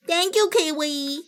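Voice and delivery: high, slow, put-on cutesy voice; annoying voice. Shown as a demonstration. Thanking Kiwi in a deliberately annoying voice. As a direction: stretch it out in a silly high voice. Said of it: "Sorry, that was an annoying voice".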